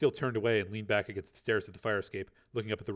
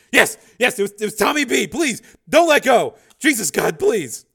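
terrified begging; 12 semitones higher, 14 dB louder than usual. Acted.

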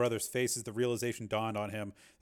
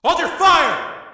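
much higher, louder command; shout; 15 dB louder, slower than usual. A narrator's reading. Rick shouting to the men in the stands. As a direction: shout the order. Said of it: "Rick shouted".

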